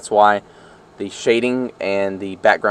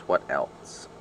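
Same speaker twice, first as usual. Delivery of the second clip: whispered to self; whispering; 7 semitones lower, 10 dB quieter than usual. Real, not acted. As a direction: trail off into a whisper. Thinking aloud, trailing to whisper.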